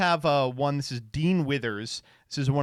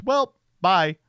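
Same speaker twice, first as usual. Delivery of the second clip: higher, louder, careless "Well, bye"; mock breezy goodbye; 6 semitones higher, 8 dB louder than usual. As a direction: toss it off like a shrugging quitter. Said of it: "the guy who facilitated all of it just goes".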